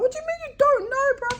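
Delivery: high, fast disbelieving cry; shocked demand at the clueless staff member; incredulous. Retold or imitated. To the staff member, a high, fast incredulous outburst. Exasperated retort to gate staff.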